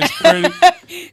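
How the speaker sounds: breaking up mid-word; laughing. Real, not acted. Host cracking up while reading the answer, laughing.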